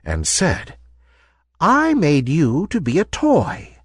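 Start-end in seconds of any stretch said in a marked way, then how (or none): 1.6-3.8 put-on higher-pitched old woman voice; gentle old lady. A narrator's reading. Old lady revealing herself to the ballerina, gentle. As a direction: explain kindly as an old toy maker. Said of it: "The old lady took".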